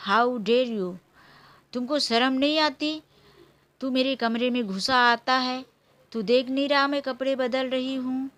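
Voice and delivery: ordinary delivery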